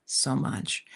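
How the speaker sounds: breathy whisper of gratitude; whispered thanks. Retold or imitated. Thanking the kind stranger, whispered. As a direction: trail off into a whisper.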